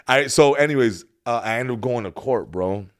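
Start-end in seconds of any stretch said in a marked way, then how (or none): none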